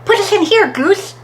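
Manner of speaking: high, loud storybook call; excited shout. A narrator's reading. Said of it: "shouted Hare".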